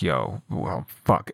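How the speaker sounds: mock exasperation. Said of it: "I just get so pissed off".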